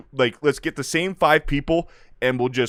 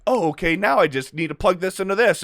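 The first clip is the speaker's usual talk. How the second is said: mock realization; 7 semitones higher than usual. Voices little Leia suddenly understanding.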